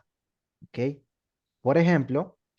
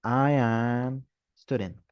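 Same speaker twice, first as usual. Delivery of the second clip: slow, deliberately wrong English; teacher models the mistake; slow wrong example; 3 semitones lower, much slower than usual. Shown as a demonstration. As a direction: say the incorrect version slowly. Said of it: "En inglés yo no puedo decir esto".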